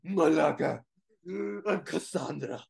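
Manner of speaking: mocking, laughing impression; bad acting. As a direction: ham up the hero lines with giggles. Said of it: "You sound nothing like that".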